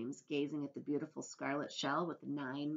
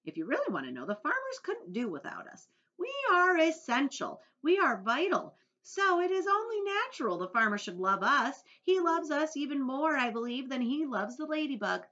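a raised, bright-eyed little beetle voice, quite pleased with herself; lightly voiced genteel ladybug, raised and proud; 10 semitones higher, 9 dB louder than usual. A narrator's reading. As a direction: speak as a genteel ladybug boasting of her usefulness. Ladybug character voice.

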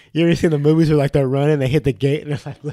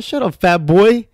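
retold taunt; 7 semitones higher, 5 dB louder, slower than usual. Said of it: "I said".

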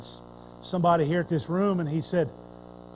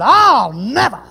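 passionate plea; 3 semitones higher, 7 dB louder than usual. Retold or imitated. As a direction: pledge to die with him. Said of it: "Peter had that passionate plea".

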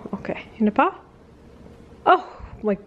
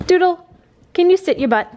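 calling the dog; 9 semitones higher, 4 dB louder than usual. Real, not acted.

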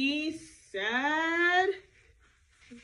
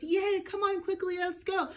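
higher, cheerful urging; eager Moses; 6 semitones higher than usual. Acted. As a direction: hurry them on brightly. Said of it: "and he says".

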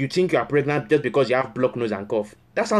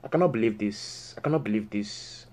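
muttered disbelief; 4 semitones lower, 6 dB quieter than usual. Acted. Counselor fuming to himself over the friend, low muttered disbelief.

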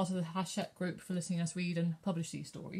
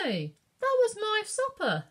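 much higher, louder outraged call; indignant shout; 13 semitones higher, 4 dB louder than usual. A narrator's reading. Carter shouts at Katie over his supper. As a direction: yell after the pie thief. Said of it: "shouted one of the men".

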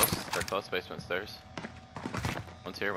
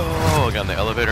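ordinary delivery

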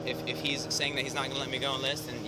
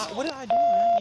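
distressed plea; 10 dB louder than usual. Real, not acted. Handcuffed man to officer, pleading in distress. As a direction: voice jumps far higher and louder on man.

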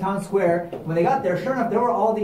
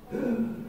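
dramatic gasp; 5 semitones higher, 7 dB quieter than usual. Acted. Children hear about the shotgun.

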